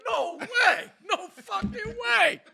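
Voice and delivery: high, loud re-enacted shock; loud disbelief. Driver spotting police lights behind him, loud disbelief. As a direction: cry out in disbelief, drawing out the second one.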